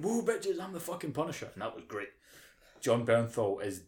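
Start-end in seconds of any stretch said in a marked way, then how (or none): 0.0-1.5 swaggering Punisher. Retold or imitated. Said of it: "just went".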